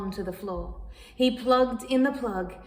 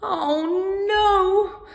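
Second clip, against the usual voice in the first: high, loud wailing oh no; cat wailing at the flea; 9 semitones higher, 7 dB louder than usual. Acted. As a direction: wail in despair. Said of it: "wailed the cat".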